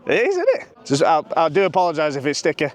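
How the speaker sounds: panting after the penalties; out of breath. Real, not acted. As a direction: talk while catching your breath. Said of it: "I'm out of breath".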